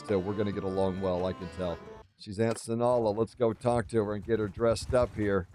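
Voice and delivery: usual speaking voice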